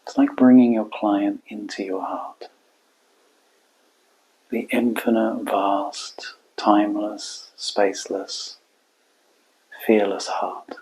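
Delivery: quiet, spacious meditation voice; guided meditation. Acted. Coach guiding listeners to hold client and heart, quiet meditation. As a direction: let each phrase settle into a long silence.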